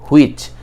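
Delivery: "হুইচ" with an H sound before the W; common mispronunciation. Shown as a demonstration. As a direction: start "হুইচ" on a sounded H, hu-ich. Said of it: "অনেকেই আমরা করে ফেলি হুইচ".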